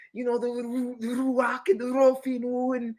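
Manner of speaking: mock their borrowed way of talking; imitating affected talk. Imitating Zion Lex and them talking, in a mocking put-on voice. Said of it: "you don't even talk like that".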